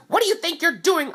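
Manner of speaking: high, loud imitation of an outraged mom; angry mom. Pushed mom confronting the teller, angry high imitation.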